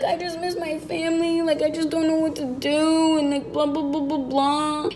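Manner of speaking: a hint of a crying man pleading; imitating his crying. The ex crying on the stairs to her, mild tearful plea. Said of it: "And he was crying".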